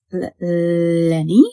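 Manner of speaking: slow, halting call into the dark; hesitant call. A narrator's reading. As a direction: call the dog's name unsure and scared. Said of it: "Shivani called again".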